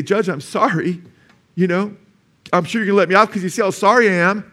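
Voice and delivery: high-pitched, hammed-up plea to the judge; mock begging for mercy, pitched high; mock tearful pleading. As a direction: plead like a bawling defendant, half-acted. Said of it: "you're crying, you're bawling".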